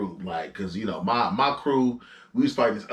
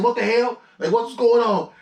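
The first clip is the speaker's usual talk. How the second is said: high, louder mock alarm; startled confusion; 10 semitones higher, 5 dB louder than usual. Fighter re-enacting being sneak punched while drunk, startled confusion. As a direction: blurt it out, bewildered.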